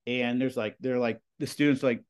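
ordinary talk

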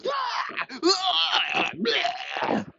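high-pitched mock shrieks. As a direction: shriek wildly like a kid in panic. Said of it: "all of a sudden he hears".

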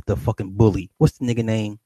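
normal talking voice

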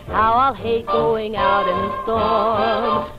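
normal talking voice

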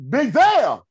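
high, loud hail; shouting for attention; calling out. Retold or imitated. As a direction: call his name across the lot. Calling out a name.

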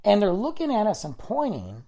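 ordinary delivery

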